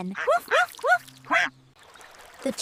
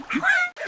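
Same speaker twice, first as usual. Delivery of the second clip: a sharp quack, much higher; duck quack. Acted. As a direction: quack like an excited little duck.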